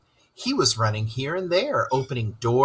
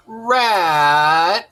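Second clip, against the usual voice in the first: moaned name, full of gloom; moaning; 6 semitones higher, 6 dB louder than usual. A narrator's reading. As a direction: moan it out despairingly. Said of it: "he moaned".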